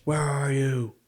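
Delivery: imitation of a menacing movie hero; Batman. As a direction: put on a masked vigilante's voice. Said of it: "I do like his Batman voice".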